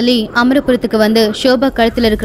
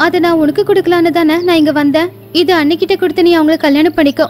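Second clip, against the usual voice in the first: put-on higher, earnest young bride setting her condition; a firm young woman laying down one rule; young woman's voice; 5 semitones higher than usual. Kamala confesses to brother. (உறுதியான இளம் பெண்ணின் குரல்)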